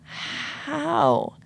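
a high, breathless "How?"; stunned disbelief. Retold or imitated. Finding cash in the bushes, asking herself in amazement. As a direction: ask it as if the money appeared by magic. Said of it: "I'm like".

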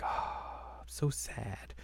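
soft, breathy mock sigh of sadness; hammed-up whispery sadness; mock sad Kagome. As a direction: sigh it out faintly, fake moping. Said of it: "She's very like".

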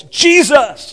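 sudden high, loud cry; very loud blurt. Retold or imitated. Mother-in-law reacting to shocking TV news, very loud blurt. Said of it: "blurt out in a very loud voice".

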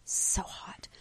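breathy whisper aside. Real, not acted. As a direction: whisper it under your breath.

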